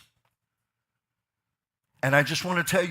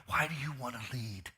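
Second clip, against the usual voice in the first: soft question; 8 dB quieter than usual. Shown as a demonstration. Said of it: "Can I ask you a question?".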